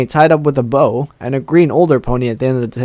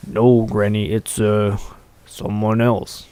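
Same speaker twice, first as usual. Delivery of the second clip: low, soft, hesitant big fellow; big male pony; 4 semitones lower, 6 dB quieter, slower than usual. Acted. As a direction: answer Granny quietly in a deeper voice.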